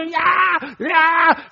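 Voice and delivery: very high, shrill-free mock baby yelling; crying baby. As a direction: play the impatient baby demanding now. Baby demanding milk.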